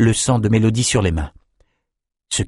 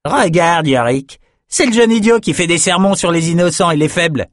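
higher-pitched, jeering brute; mocking Norukai raider; 8 semitones higher, 3 dB louder than usual. A narrator's reading. Lars mocks Bannon to his companion Yorick, jeering. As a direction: jeer at the young idiot.

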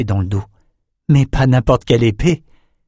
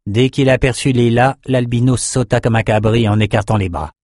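usual speaking voice

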